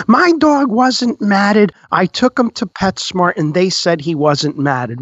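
high-pitched mock scream, slowing at the start; screaming wife. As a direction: imitate the wife yelling down the phone. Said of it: "She screams into the phone".

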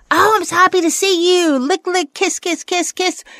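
high, fast cooing kisses; gushing sweet cat. Retold or imitated. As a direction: coo like a lovey-dovey pet. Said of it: "cats will lick not because".

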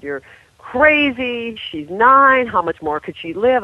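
high, drawn-out "crazy" in mock disbelief; voicing incredulous friends; disbelieving critics. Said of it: "everyone said".

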